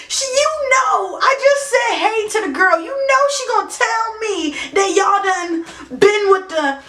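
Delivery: ordinary delivery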